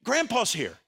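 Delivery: calm a frightened grandchild; comforting parent. Pretend. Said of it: "our first response would be this".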